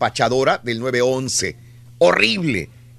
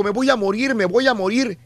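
higher panicked voice, a touch of mock sobbing; imitated crying; 6 semitones higher than usual. Host imitating the dying woman's plea to 911, mildly tearful. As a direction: repeat it like someone afraid of dying.

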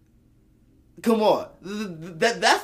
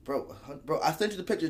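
normal talking voice

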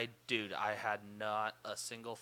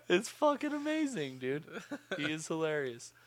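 normal talking voice

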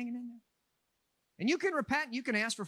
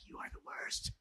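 mock whisper; 13 dB quieter than usual. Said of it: "My wife is like".

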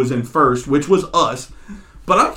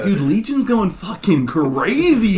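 slow, drawn-out, higher-pitched exclamation; reenacting an awed gamer callout; hyped callout; 5 semitones higher, slower than usual. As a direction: stretch out 'crazy' in mock amazement. Repeats own hyped game callout.

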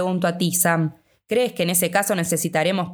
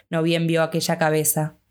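ordinary delivery

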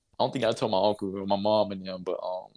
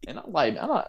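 ordinary talk

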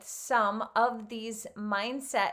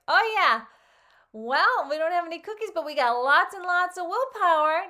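high, loud mock-triumphant retelling; imitating Frog; 7 semitones higher, 7 dB louder than usual. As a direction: ham up the proud boast. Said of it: "he was like".

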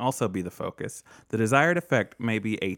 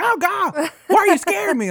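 high, loud imitation of a jumpy friend; mock startled shriek; 8 dB louder than usual. Host mimics a friend scared round the corner, mock panic. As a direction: exaggerate a panicked, accusing yelp. Retelling Amber's startled reaction.

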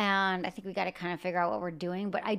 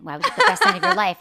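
mock accusing boyfriend, higher and louder; hammed-up jealous demand; mock jealous guy; 10 semitones higher, 12 dB louder than usual. Retelling her boyfriend grilling her over a podcast line, mock jealous. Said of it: "He's like".

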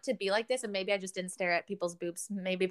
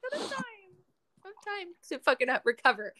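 laughing rant; 8 semitones higher, 7 dB louder than usual. Real, not acted.

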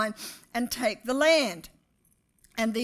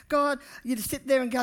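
ordinary delivery

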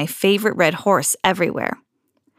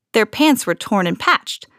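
ordinary delivery

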